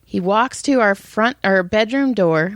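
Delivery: usual speaking voice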